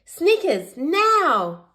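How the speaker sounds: high, loud signal call; Bluey calling her friend to spring the trap, loud and high; shouting. A narrator's reading. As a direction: give the go signal across the hill. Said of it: "Bluey shouts to Snickers".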